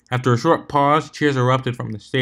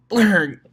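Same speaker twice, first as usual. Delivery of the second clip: a high, louder silly blurg; goofy blurg; 10 semitones higher, 6 dB louder than usual. Acted. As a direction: squawk it like a cartoon. Hoof pressed through her head.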